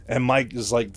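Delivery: usual speaking voice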